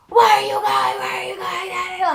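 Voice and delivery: high, loud hammed-up yell; exaggerated high-pitched shouting; mock screaming. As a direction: imitate his screaming, starting loud and easing off.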